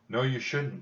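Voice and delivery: usual speaking voice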